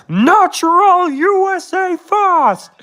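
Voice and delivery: hammed-up American accent slogan; overblown Trump accent. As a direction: bellow a slogan like a cartoon president. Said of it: "in an overblown accent".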